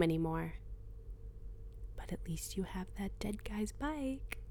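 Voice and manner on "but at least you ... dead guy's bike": hushed inner voice, close to the ear; a voice inside her head, whispering; whispered inner voice. Acted. Inner voice jab.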